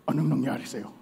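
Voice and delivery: a touch of movie-tough-guy menace; Max Alvarado villain. Acted. Lion asking the trapped mouse, lightly voiced like a movie villain. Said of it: "Parang Max Alvarado lang".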